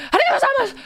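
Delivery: high-pitched rushed garble of the phrase; imitating a botched speedy thank-you; fast, messed up. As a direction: race through it and trip over the syllables. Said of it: "saying it fast too, but sometimes I would mess up".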